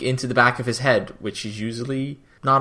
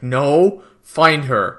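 louder, emphatic retold command; firm order; 3 semitones higher, 6 dB louder, much slower than usual. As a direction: shut the idea down loudly.

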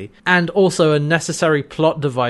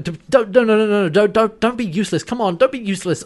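higher, stammering mock desperation; frantic urging; 6 semitones higher, faster than usual. Eren urging John not to give up, frantic pleading. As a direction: pile up the don'ts, pleading urgently. Acts out Eren's desperate plea.

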